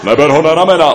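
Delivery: warning. A narrator's reading. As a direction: warn him off sharply. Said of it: "varoval pták Noach".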